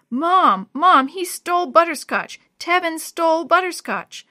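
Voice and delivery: loud, high-pitched accusing cry; distressed outcry. A narrator's reading. Jenny, in tears, accusing Tevin to her mom, distressed shouting. Tearful child crying out to mother.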